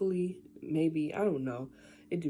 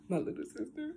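usual speaking voice

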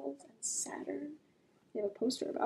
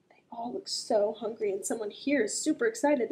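plain reading voice

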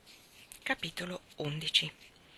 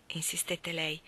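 plain reading voice

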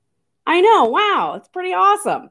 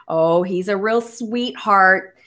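ordinary delivery

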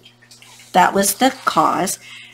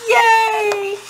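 high, drawn-out yay; long high celebratory squeal; cheering; 13 semitones higher than usual. Real, not acted. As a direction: cheer the happy ending. Cheering the story's ending.

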